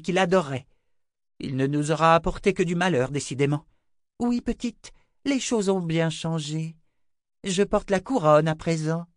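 high, proud, icy gloating; cold gloating queen. A narrator's reading. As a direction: lord it over the girl. Young queen gloats coldly.

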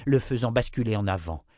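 ordinary delivery, nothing marked

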